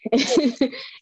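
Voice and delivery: quick high real laugh; short bright laugh before going on; burst of laughter. Real, not acted. Laughs at her own point.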